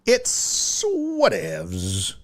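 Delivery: dismissive, slow "It's whatevs"; drawn-out whatevs. Acted. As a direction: drag out "whatevs" lazily. Repeats the phrase drawn out.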